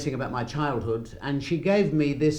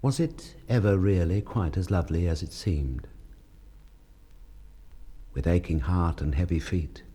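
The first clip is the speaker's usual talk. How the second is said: poem reading; 9 semitones lower, 4 dB quieter than usual. Acted. Husband reading his wife's poem, softly and lovingly. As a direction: read it gently, as a memory.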